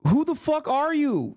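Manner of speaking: building from a slow scold to a high incredulous cry; mock outrage that rises and rises; retold disbelief. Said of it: "I'm like".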